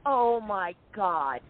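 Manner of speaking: slowly stretched oh my God; slow, dismayed oh my God; drawn out dread. Retold or imitated. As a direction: let it drag out in dismay. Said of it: "And I'm like".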